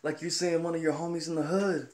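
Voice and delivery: high mock yell with no real volume; mock yelling demo. Older manager coaching a worker to yell, high mock call. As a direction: imitate a manager pushing for a yell. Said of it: "you gotta yell to".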